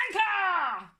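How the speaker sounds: proud loud shout of anchor; proud exclaim. Acted. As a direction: announce it proudly. Proudly shows his drawing.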